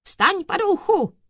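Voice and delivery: high, loud thundering command; thundering judge. A narrator's reading. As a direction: thunder it at the accused. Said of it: "zahřímal na něj doktor Corpus".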